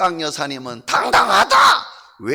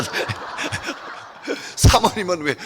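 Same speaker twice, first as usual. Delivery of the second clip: usual speaking voice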